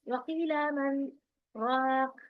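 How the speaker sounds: slow वकील मन, brief breathless hold, then राक; measured recitation with a short silent gap before राक; saktah pause after मन. Shown as a demonstration. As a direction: stop after मन without inhaling, continue into a drawn-out राक. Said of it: "दो हरकत के बराबर रुकना चाहिए मगर सांस ना टूटने पाए".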